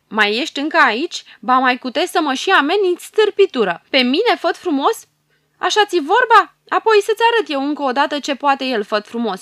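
the hero furious at being threatened; truly furious. Acted. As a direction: explode with rage at his nerve. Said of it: "se înfurie de-a binelea".

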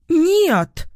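high-pitched outburst of refusal; sudden shout. A narrator's reading. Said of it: "вдруг кричит она". (внезапный высокий выкрик)

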